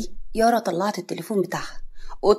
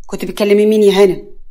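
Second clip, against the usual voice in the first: loud, jealous interrogation; sharp jealous accusation, acted loudly; 8 dB louder than usual. A narrator's reading. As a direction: demand an answer, raised voice. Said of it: "إمام واقف بكل غيرة".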